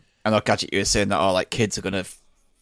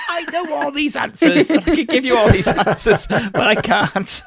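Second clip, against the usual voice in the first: high, strained, laughing squeak; high squeaky mock voice; 4 dB louder than usual. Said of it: "I'm going".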